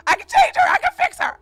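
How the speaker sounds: high, loud mock of deluded men; hammed-up mock of a man. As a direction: squeal it like a smitten fool. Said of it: "What they say".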